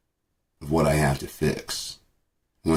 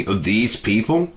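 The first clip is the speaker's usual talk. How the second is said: slow, higher, rising; mock disbelief; 5 semitones higher, much slower than usual. Vlogger scoffing at the cashier, slow mocking disbelief. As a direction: drag it out in scornful disbelief. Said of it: "repeats mockingly".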